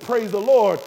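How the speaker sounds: a loud, glad burst of praise; joyful praise. Real, not acted. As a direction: cry it out with joy. Joyful reply to good news.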